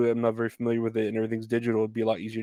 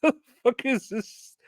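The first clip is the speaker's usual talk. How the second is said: pitched-up incredulous outburst; baffled; 13 semitones higher than usual. Retold or imitated. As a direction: exclaim in disbelief, voice jumping up. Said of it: "I was like".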